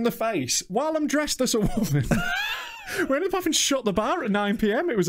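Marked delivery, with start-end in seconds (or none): 2.1-3.0 high shrieking laugh. Real, not acted. Loud laugh shout.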